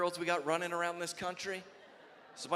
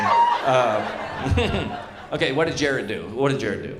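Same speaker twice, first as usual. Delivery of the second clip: normal talking voice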